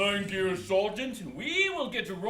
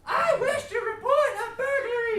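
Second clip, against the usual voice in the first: higher-pitched, strained voice; high register; 7 semitones higher than usual. Acted. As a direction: push the voice up high. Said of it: "try it in a higher register".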